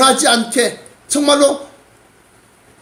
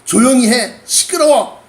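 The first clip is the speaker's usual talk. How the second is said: raised, sharp hushing orders; scolding. Acted. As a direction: rebuke him as the annoyed crowd. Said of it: "꾸짖어". (꾸짖는 높은 목소리)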